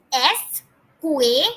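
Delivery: slow, careful Spanish escuela, each syllable clear. Shown as a demonstration. Teacher models escuela slowly for students. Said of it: "let's say it together".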